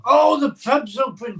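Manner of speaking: loud, high cry of delight; pub's open, cried out loud and high; excited. Real, not acted. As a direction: burst out with joy that the pub is open. Calling out excitedly.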